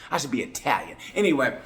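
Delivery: ordinary delivery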